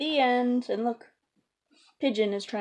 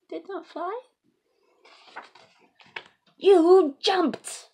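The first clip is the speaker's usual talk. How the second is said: much higher, louder on jumped; can't believe it; incredulous; 10 semitones higher, 11 dB louder, much slower than usual. Acted. Elephant telling Piggy she only jumped, higher, louder.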